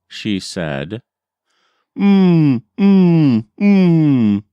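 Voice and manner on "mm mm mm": Nana Boozhoo copying the owl's high hum; imitating owl hum. Grandson imitating the owl for his grandmother, high hum. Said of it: "was the sound it made".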